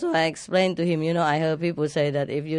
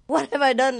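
voicing the dog, What have I done, lightly higher and puzzled; dog's puzzled look; 6 semitones higher than usual. Shocked dog staring at its owner, mildly puzzled voice. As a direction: give the dog a bewildered little question. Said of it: "he was looking at me like this".

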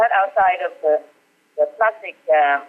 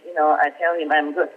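ordinary delivery

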